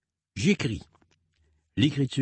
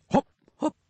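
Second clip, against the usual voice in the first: much higher springy hop sounds; bouncy hops. Pretend. Parent cueing the child to jump, high bouncy hops. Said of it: "saute sur place".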